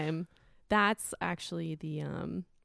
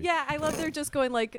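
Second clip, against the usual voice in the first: ordinary talk, nothing marked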